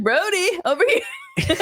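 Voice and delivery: high-pitched call across the room; mock call. Imitating Cody calling Brody over, high-pitched. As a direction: sing out his name, way up high.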